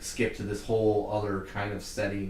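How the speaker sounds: normal talking voice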